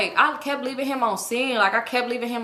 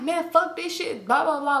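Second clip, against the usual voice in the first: higher-pitched mock fed-up griping; retold annoyed outburst, pitched up; imitated angry friend; 6 semitones higher than usual. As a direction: act out a mad friend's complaint. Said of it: "Brittany mad as fuck".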